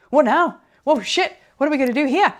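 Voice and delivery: mock panic. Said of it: "suddenly divert over there and go".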